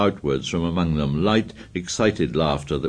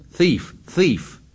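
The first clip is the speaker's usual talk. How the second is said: high angry shout; shouting accusation; 10 semitones higher than usual. A narrator's reading. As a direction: hurl the word twice. Ralph hurling accusation.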